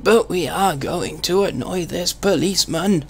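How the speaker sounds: put-on a frail old gentleman, voice squeezed in the throat; old man voice. Old man voice for the traffic cop.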